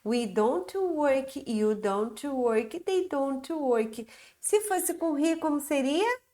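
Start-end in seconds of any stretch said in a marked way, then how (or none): 0.0-4.1 very slow, carefully spaced drill; slow teacher's model reading; slow model sentences. Shown as a demonstration. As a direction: spell each word out slowly for the class. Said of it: "Então, ó".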